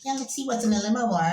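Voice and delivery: high, bright put-on voice; mischievous teen. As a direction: pitch it up, sneaky and eager about the limo bar. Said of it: "I'm like".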